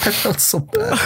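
high squeak between laughs; guilty laughing. Real, not acted. Teller confesses guilt over the old man, high and half laughing. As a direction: squeal it, nearly overcome with laughter.

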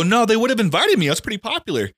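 high, protesting retold denial; mock denial. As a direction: act out insisting you'd have been invited. Retold denial of being left out.

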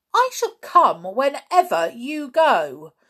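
loud, high, slow defiance; flashing back. A narrator's reading. George defying Julian, loud high defiance. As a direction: fire it straight back at him. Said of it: "flashed back George".

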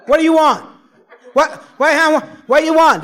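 high, loud hallucinated shouting; hollering. Retold or imitated. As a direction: bellow it like a voice from the speaker box. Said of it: "somebody started hollering at me".

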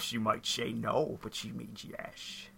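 slow, low, hushed star impression; Sean Connery. Podcaster's inner voice egging him on, slow celebrity impression. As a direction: drag it out softly as the famous actor. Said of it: "It's me, Sean Connery".

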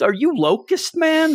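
high, hammed-up gasp of a question; mock astonishment. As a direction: squeak it out in fake awe. Mocking a character's question.

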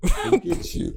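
breathy mock dub; censored dub line. Mocking the dubbed voice again, high and unvoiced.

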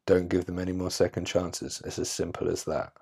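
dropped to a near whisper; soft, final, almost whispered; quiet. Real, not acted. Closing the advice to the listener, hushed.

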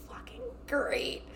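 slow breathy sarcastic whisper; muttered to herself after Larry praises her, whispered. Retold or imitated. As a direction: hiss it under your breath, fed up.

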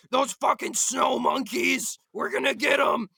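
put-on belligerent yelling; mock war cry. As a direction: imitate a rabid online war fan. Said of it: "you know, saying".

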